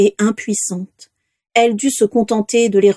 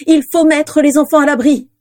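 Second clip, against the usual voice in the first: higher, louder urgent plea; breathless; 7 semitones higher, 4 dB louder than usual. A narrator's reading. Narcissa urges Eleanor to shelter the children, breathless. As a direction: push the words out short of breath. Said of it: "dit-elle le souffle court".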